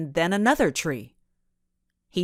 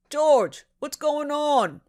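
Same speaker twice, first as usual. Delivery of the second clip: shouting; 9 semitones higher, slower than usual. A narrator's reading. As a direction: shout the question across. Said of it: "shouted a squat man".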